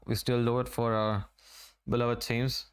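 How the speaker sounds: ordinary delivery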